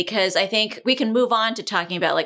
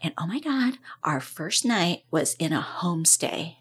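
put-on excited teen girl; 6 dB quieter than usual. Girl voiced retelling trip.

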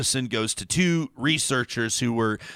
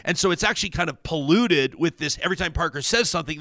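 ordinary talk, nothing marked

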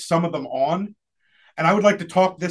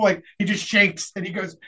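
usual speaking voice

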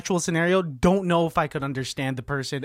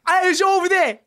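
high-pitched comic Cockney; high, excitable Cockney caricature; bad Cockney accent; 11 semitones higher than usual. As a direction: yelp it as a broad improv Cockney. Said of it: "us being two cockneys".

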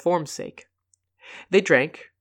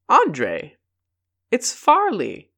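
a lively hail, the second line up high and slow; excited call; 8 semitones higher, 4 dB louder, much slower than usual. A narrator's reading. Farley hailing his friends across the street, excited call. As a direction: call out to a friend you've just spotted.

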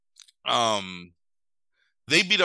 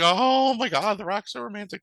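much higher, gushing mock fangirl squeal; mock swooning; 10 semitones higher, 3 dB louder than usual. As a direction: gush over him like a swooning fan. Mocking gushing fan reaction.

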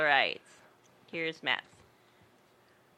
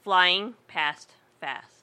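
slow deliberate; 3 dB louder, much slower than usual. Shown as a demonstration. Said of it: "reading tongue twister slowly".

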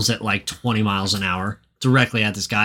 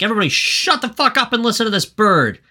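high, fast mock yell; Wilma yelling; 11 semitones higher, faster than usual. Wilma orders everyone to listen to the bird, high fast mock yell. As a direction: bark it at the crowd like an angry housewife.

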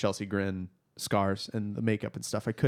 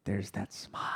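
very soft, lower whisper; whispered recognition; 3 semitones lower, 11 dB quieter than usual. Retold or imitated. Fan spots that smile in the movie, whispering. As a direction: whisper it as if spotting it. Re-enacts spotting the Joker smile.

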